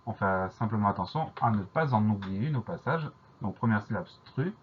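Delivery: ordinary delivery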